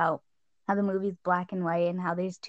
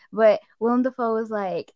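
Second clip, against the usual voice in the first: ordinary talk, nothing marked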